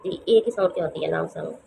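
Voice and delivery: ordinary talk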